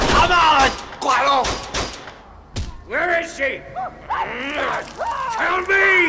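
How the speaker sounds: furious demand. Acted. Lynch yelling at Guaro to say where she is. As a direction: demand the answer with rage.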